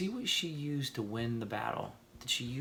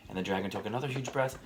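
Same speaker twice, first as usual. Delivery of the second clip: plain reading voice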